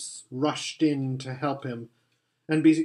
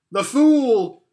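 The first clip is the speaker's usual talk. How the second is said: high, loud witch cry of scorn; 9 semitones higher, 8 dB louder than usual. Acted. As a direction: fling it out as the cruel witch. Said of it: "she cried".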